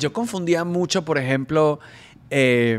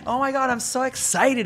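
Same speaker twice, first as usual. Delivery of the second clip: mock American gush; 9 semitones higher than usual. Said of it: "veía a la gente súper normal".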